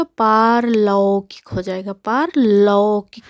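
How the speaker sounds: slow पारलौकिक with a broad औ on लौ; teacher modelling slowly; correct pronunciation. Shown as a demonstration. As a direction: draw out लौ in पारलौकिक. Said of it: "अशुद्ध है".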